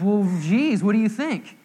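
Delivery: comic pretend indignation; sarcastic retort. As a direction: answer as if the question is ridiculous. Imagined sarcastic reply.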